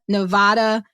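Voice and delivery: Nevada with "ah" in the middle; alternate pronunciation. Shown as a demonstration. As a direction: say Nevada as nuh-VAH-duh. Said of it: "I've heard Nevada pronounced so many ways".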